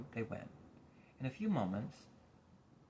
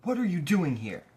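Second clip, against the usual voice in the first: louder, higher, gruff demand; growl; 6 semitones higher, 12 dB louder than usual. A narrator's reading. Watchdog growling at Milo, gruff demand. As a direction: growl it at the boy. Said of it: "growled the watchdog".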